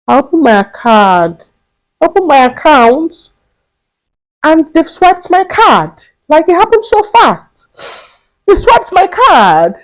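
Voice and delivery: high, loud disbelief building; rising shock. Real, not acted. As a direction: relive the shock of being robbed. Reliving card theft in shock.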